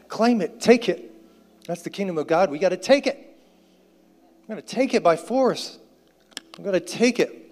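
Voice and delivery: high, urgent preacher's rallying cry; fired-up preaching, punchy and high; rousing exhortation. Real, not acted. Rousing the congregation to claim it.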